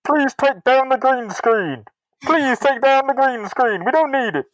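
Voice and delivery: high, slow, theatrical commands; grand villain voice. Pretend. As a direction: order the crew about like a pompous stage villain. Mysterio addressing his crew.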